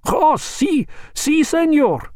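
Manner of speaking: put-on very high, eager Spanish accent; nervous master in a Spanish accent feigning cheer; forced cheerful ease. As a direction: fake a breezy cheerfulness. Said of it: "with an attempt at cheerful ease".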